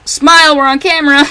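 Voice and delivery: a hint of a chirpy animatronic posing for a selfie; bunny character calling for a selfie, upbeat; Spring Bonnie voice. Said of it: "It's Spring Bonnie's voice".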